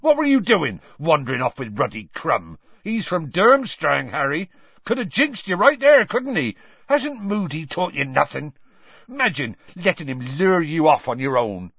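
angry, high-pitched scolding; Hagrid. A narrator's reading. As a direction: scold a boy for reckless wandering. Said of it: "Hagrid suddenly said angrily".

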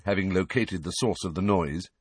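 plain reading voice

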